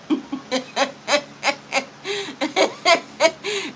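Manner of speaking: high, breathy laughing fit; long laugh. Real, not acted. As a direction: burst out laughing at the comment. Laughing at a viewer's comment.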